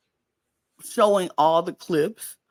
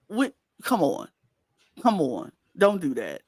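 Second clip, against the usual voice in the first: ordinary talk, nothing marked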